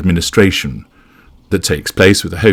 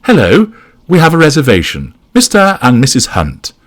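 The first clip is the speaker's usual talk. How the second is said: high, louder self-important announcement; announcing; 8 semitones higher, 4 dB louder than usual. A narrator's reading. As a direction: announce yourselves brightly at the desk. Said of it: "announces Jane".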